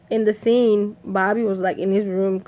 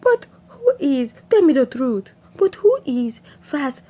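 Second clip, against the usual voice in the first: humble; 5 semitones higher than usual. Retold or imitated. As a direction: plead meekly in a raised voice. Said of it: "he was all humble".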